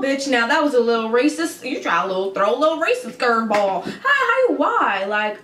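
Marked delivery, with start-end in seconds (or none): 3.8-5.0 laughing disbelief. Real, not acted.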